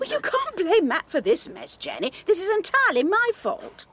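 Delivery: high, loud self-reproach; upset outburst. Acted. Woman defends Matt to Jenny, upset. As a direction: protest hard, taking all the blame.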